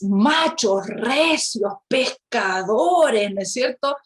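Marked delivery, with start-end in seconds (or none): none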